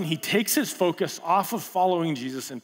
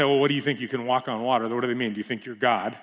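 sarcastic; 6 semitones lower, faster than usual. Retold or imitated. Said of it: "particularly sarcastically".

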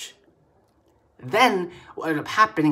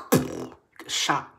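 much deeper and slow, each word dragged out; ominous repeat; slower than usual. Acted. Repeating the killing fields danger to the audience, deep and slow. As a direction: lower the voice into a dark, heavy warning. Repeats the grim line for weight.

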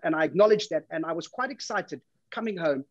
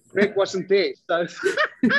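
laughing; 3 semitones higher, 3 dB louder than usual. Real, not acted.

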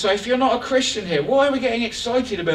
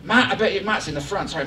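ordinary talk

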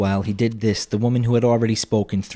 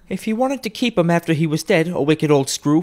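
brazen woman; 9 semitones higher than usual. A narrator's reading. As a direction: chide her for staring. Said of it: "pursued the woman".

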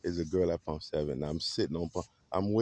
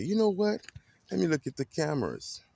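security guard; 10 semitones higher than usual. Retold or imitated. Guard offers to check the cameras, high put-on voice. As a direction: pitch the guard up high and eager. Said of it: "the security guard said".

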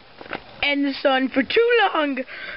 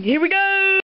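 excited announcement; 7 semitones higher than usual. Acted. Announcing the flashback.

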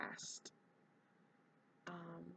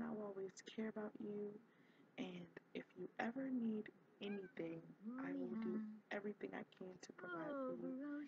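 Delilah softly promising her niece; tender aunt; 10 dB quieter than usual. Retold or imitated. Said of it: "kind of saying".

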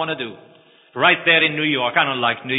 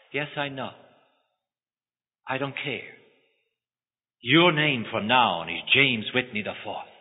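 much softer, calm and sure; gentle insistence; 4 dB quieter than usual. Acted. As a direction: quietly brush his fear aside.

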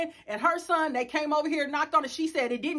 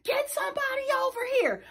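much higher, fast frantic plea; panicked daughter; 12 semitones higher, faster than usual. Retold or imitated. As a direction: rush it out like a scared kid. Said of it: "My daughter called me in a panic".

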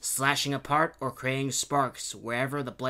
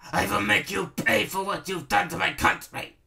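high, louder villain's rant; angry ranting; 8 semitones higher, 5 dB louder than usual. A narrator's reading. Baron vowing revenge on Princess Kitty, ranting angrily. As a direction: rant vengefully at your enemy. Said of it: "he ranted angrily".

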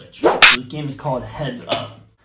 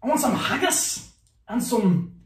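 put-on Scottish accent; 7 semitones higher than usual. Player gives partner a clue, Scottish accent. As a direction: exclaim it like a caricature Scot.

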